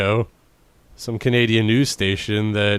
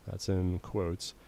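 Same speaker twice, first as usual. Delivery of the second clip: ordinary talk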